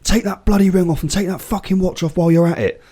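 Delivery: high, sharp mock ref order; bossy ref. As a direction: ape the ref ordering him about. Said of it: "He's like".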